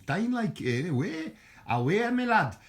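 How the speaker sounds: high-pitched, hammed-up Geordie call; Geordie accent. Vlogger mimicking grandfather's Newcastle, exaggerated Geordie accent. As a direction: burst into mock Newcastle dialect, loud at the end. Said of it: "I should be talking northern".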